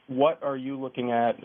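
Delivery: usual speaking voice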